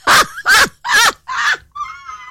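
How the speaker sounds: high, shrieking burst of laughter. Real, not acted. Host cracking up at listener's lazy habit, shrieking laugh. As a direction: let out a squealing laugh of disbelief. Reacting to cold-water teeth message.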